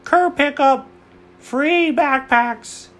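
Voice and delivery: high, drawn-out, loud sales call; calling out an offer. Pretend. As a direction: hawk it slowly like a street seller. Dad calls out curbside giveaway.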